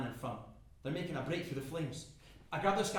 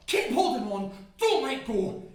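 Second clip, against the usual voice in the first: loud, raised, driving command; urgent shout; 9 semitones higher, 12 dB louder than usual. Acted. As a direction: urge it out forcefully, pitched up. Urging himself on through flames.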